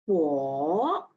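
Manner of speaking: "bo" dipping low and rising; third tone. Shown as a demonstration. Teacher drilling the third tone of "bo". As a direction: scoop "bo" down then up. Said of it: "Third tone".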